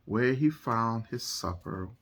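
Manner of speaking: lively storytime narration over music; picture book reading. A narrator's reading. Reading a picture book to listening children, animated storytelling. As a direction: read it like bedtime storytime.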